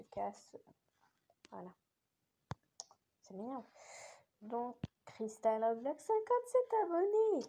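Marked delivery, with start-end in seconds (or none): 6.0-7.5 excited. Real, not acted. Excited about subscriber count.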